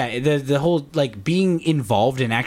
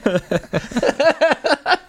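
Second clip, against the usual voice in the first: high laugh; 14 semitones higher than usual. Acted. Cackle after witch talk.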